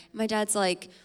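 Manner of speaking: ordinary talk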